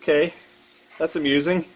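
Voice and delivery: ordinary talk, nothing marked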